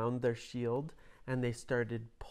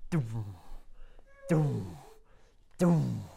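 booming footsteps; 3 semitones lower, slower than usual. A narrator's reading. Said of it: "it went".

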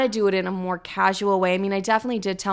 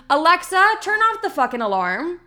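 real call-out; 8 semitones higher than usual. Real, not acted. Yelling at Alexa to stop alarm.

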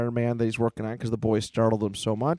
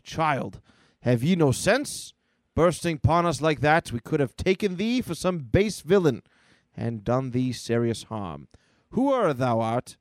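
Thor; 2 semitones higher than usual. Acted. Thor scolds the boy, grand mock-archaic declaiming. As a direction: declaim it, measured and stern. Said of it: "Thor picks up the boy".

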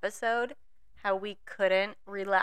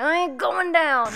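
defiant; 7 semitones higher, 7 dB louder than usual. Retold or imitated. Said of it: "and I'm like".

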